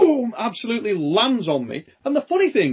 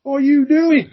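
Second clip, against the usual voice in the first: mock angry yell; 6 semitones higher, 5 dB louder than usual. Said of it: "and I'm like".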